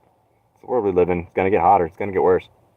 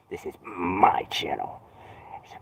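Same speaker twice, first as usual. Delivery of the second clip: hushed secretive whisper; 3 semitones lower, 9 dB quieter than usual. Acted. Streamer whispering to empty chat, possessive. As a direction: breathe it like a secret.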